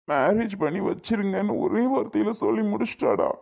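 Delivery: breaking into sobs while talking; crying. Acted. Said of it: "அழாதடா". (அழுதுகொண்டே உடைந்த குரலில்)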